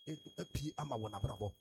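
loud, high, driving shout; preaching at full force. Real, not acted. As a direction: whip the congregation into prayer with repeated shouted calls.

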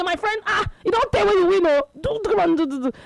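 high, urgent mock scolding; retold shouting. Wife shouting at husband during his match, mock shouting. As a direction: imitate a wife yelling orders. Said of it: "the wife dey shout for am".

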